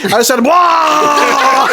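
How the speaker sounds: sentence bursting into a mock retch; high, drawn-out fake puke on the last word; vomiting mid-word. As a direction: imitate a drunk cut off by his own heave. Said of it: "he does it mid-word".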